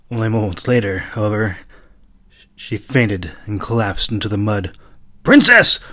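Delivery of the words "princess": high, loud cry of alarm; horrified shout. A narrator's reading. Rukrith shouting in horror as the princess faints. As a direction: shout as she collapses. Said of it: "Rukrith shouted in horror".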